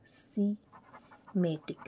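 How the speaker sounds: slow dictation. Shown as a demonstration. Teacher dictating to note-takers, semantic slowly syllable by syllable.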